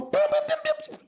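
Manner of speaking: rapid high-pitched bim bim gunfire sounds; machine gun noises. Retold or imitated. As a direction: fire off the bims like a toy machine gun. Imitating a gumball machine gun.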